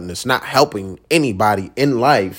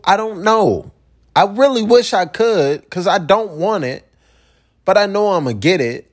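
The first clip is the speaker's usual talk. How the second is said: exasperated; 8 semitones higher than usual. Real, not acted. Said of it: "rant rises high".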